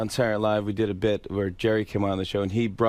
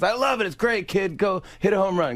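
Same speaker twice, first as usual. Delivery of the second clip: higher-pitched lightly imitated encouragement; a hint of the comedian cheering someone on; Adam Sandler; 10 semitones higher than usual. Guest retelling Sandler laughing and approving, light high impression. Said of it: "He goes".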